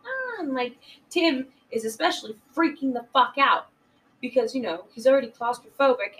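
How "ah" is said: higher, hammed-up little screams; a jokey imitation of panic; mock panicked cries. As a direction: squeal like you're scared of the shark. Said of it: "they're just like".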